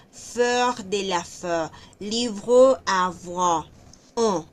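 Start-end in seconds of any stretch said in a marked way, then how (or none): 2.0-3.7 "livre à voir" stretched out slowly, mouth rounded; slow French demo. Shown as a demonstration. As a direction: draw out "livre à voir" for the class. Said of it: "tienden mucho a hacer este así". (pronunciación lenta de "livre à voir")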